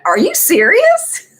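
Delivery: a hammed-up, high-pitched are-you-kidding; incredulous disbelief. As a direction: replay your stunned disbelief at the stranger. Retold incredulous reaction at elevator.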